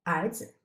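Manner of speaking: 儿子 with a short, toneless 子; lighter tone on 子. Shown as a demonstration. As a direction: stress 儿 and let 子 drop lightly in 儿子. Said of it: "in the lighter tone".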